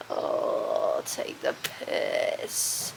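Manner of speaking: unvoiced, very slow and soft; breathy slow whisper at the weather; breathless exasperated whisper. Real, not acted. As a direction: let it out under your breath in disbelief. Muttering exasperated.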